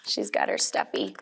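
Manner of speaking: whisper. Real, not acted. Aside to viewer about picture.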